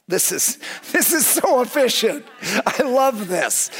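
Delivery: high-pitched, thrilled exclamation; giddy with own cleverness; gleeful self-congratulation. Retold or imitated. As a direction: gush with delight, drawing out the last words. Acts out delight at his own cleverness.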